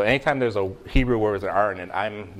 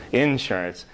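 "Insurance" with the beat on IN; first-syllable stress; 2 semitones higher than usual. Shown as a demonstration. Pastor repeats the Southern way for listeners, "Insurance" stressed on IN. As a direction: punch the opening syllable of "Insurance". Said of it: "people pronounce it like you just did".

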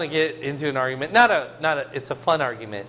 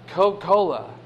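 slurred Southern Coca-Cola. Retold or imitated. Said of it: "everything's kinda slurred together".

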